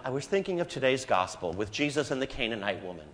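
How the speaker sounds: usual speaking voice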